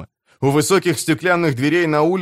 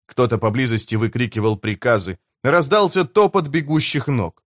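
plain reading voice

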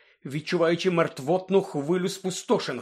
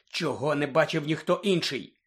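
ordinary delivery

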